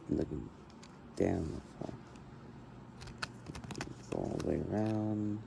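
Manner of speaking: soft, hushed voice; quiet at night. Real, not acted. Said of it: "I'm trying to stay quiet".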